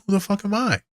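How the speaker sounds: high, incredulous squeak of disbelief; sudden high-pitched who-am-I disbelief; incredulous outburst. Real, not acted. Therapist doubting himself, high disbelieving squeak. Exasperated self-question.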